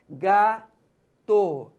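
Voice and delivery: Gato read out slowly and clearly; sounding out. Shown as a demonstration. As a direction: sound out Gato as the child reads it. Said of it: "ela fala gato".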